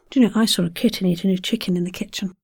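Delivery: quick kitten chicken kitchen; fast. Shown as a demonstration. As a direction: rattle it off fast. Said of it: "tongue twister, takes".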